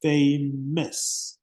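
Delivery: "Famous" in two separate beats, fay, mus; broken into syllables. Shown as a demonstration. Teacher showing a two-syllable adjective, "Famous" split in two. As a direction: pull "Famous" apart at the syllable break. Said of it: "uh-huh, two".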